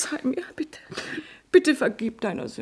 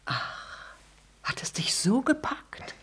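wounded whisper; 7 dB quieter, slower than usual. Acted. Actress asks her lover.

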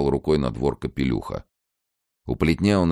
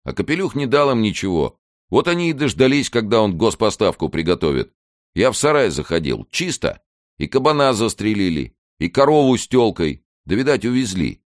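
voiced villager reporting with raised pitch; higher, animated peasant telling what happened; villager's account; 9 semitones higher, 5 dB louder than usual. Villager reporting on Капелюх's livestock, animated account.

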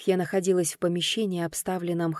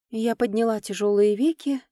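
usual speaking voice

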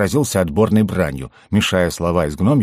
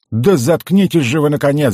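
high, loud furious outburst; exasperated roar; 8 semitones higher, 5 dB louder than usual. A narrator's reading. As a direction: explode in exasperation. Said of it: "взревел Халас".

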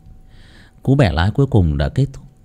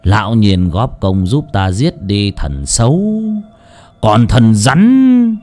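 gloating villain; 3 semitones higher, 6 dB louder, slower than usual. Acted. Lão Tuân confessing to dying Thầy Nghiêm, gloating and menacing. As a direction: relish the confession, bursting into loud high outbursts.

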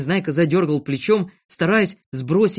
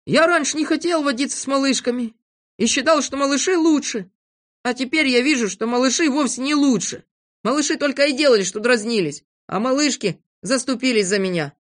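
put-on high, emphatic boyish voice; earnest boy character, higher than narration; reformed little boy; 10 semitones higher, 4 dB louder than usual. A narrator's reading. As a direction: declare it heartily, pitched up. Voices boy declaring change of heart.

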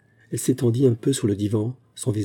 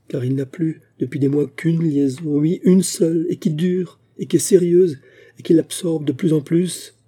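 higher, insistent, wounded voice; distressed confiding; 6 semitones higher, 4 dB louder than usual. Acted. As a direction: pour out the hurt, pressing each phrase. Distressed wife confiding husband's affair. (confidence douloureuse, voix montée)

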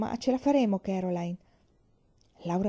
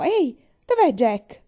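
put-on louder, slower child's call of alarm; alarmed cry; 5 semitones higher, 7 dB louder, slower than usual. A narrator's reading. Laura crying out that Jack is missing. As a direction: call out suddenly, afraid for the dog. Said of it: "Laura gridò".

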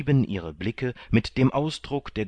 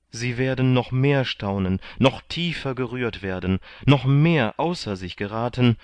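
higher, emphatic build-up to big news; animated, raised voice; excited announcement; 5 semitones higher, 4 dB louder than usual. A narrator's reading. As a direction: tease astonishing news with rising eagerness. Candide announces astonishing news.

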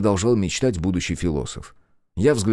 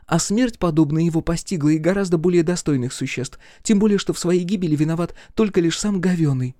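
high-pitched indignant tirade; exclaiming; 10 semitones higher than usual. A narrator's reading. Girl condemns the dead villain, exclaiming. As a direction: argue it high and insistently. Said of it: "воскликнула Олли".

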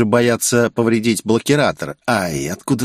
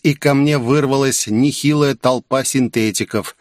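plain reading voice

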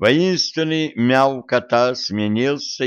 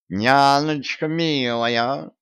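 slow, higher pleading plea; 5 semitones higher, much slower than usual. A narrator's reading. Cat Vaska begs his mistress to wait, pleading. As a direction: beg her sweetly, drawing it out. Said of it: "взмолился Васька".

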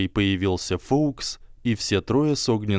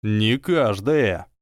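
gloomy; 3 dB louder, much slower than usual. A narrator's reading. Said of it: "мрачно хмурясь".

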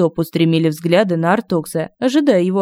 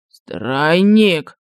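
pale, asking again; 2 semitones lower than usual. A narrator's reading. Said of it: "переспросил побледневший компатибл".

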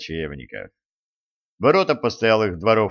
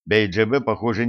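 usual speaking voice

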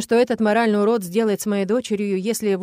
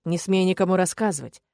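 plain reading voice

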